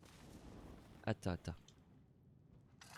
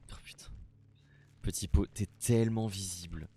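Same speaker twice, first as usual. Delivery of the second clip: ordinary delivery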